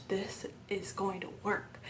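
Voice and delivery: whispered, slow, awed realisation; hushed amazement. Retold or imitated. Mother recalling the second her daughter stopped crying, hushed amazement. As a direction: whisper it slowly, as a retold private thought.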